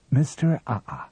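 ordinary delivery, nothing marked

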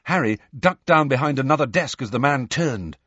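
usual speaking voice

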